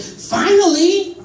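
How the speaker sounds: a loud, high, drawn-out finally; exasperated kid shout. Retold or imitated. Comedian voicing her godson yelling at the waitress, loud and drawn out. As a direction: stretch it out like a fed-up five-year-old. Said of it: "he said".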